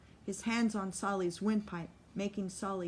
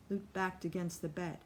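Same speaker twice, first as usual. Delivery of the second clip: ordinary delivery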